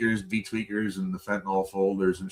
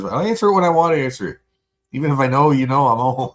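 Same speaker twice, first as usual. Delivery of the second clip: defiant rant; 6 semitones higher, 9 dB louder, faster than usual. Real, not acted. Defiant rant about answering phone.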